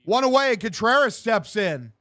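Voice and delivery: live baseball commentary; punchy sportscaster delivery; play-by-play. Acted. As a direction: call the pitch like a radio announcer.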